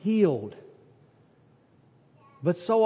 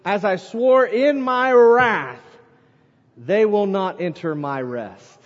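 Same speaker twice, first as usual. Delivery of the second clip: "As I swore in my wrath" louder and slow, with weight on "swore"; emphatic reading; 4 dB louder than usual. Shown as a demonstration. Preacher pointing the congregation to the key word, emphatic and slow. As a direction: lean hard into "wrath" and give it full weight. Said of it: "here's, here's our word".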